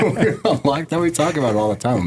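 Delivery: high, giggly and fast; laughing. Real, not acted. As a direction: laugh through the words. Laughing at covid binge memory.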